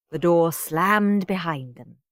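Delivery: plain reading voice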